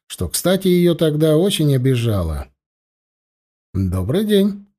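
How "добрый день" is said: courteous, slightly lifted hello; polite greeting. A narrator's reading. Narrator greets the blonde stranger, politely. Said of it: "вежливо поздоровался я".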